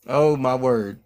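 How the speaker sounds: plain reading voice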